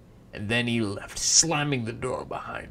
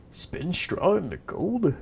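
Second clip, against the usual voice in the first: loud, higher astonished question; amazed king; 6 semitones higher, 6 dB louder than usual. Acted. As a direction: gasp it out in wonder.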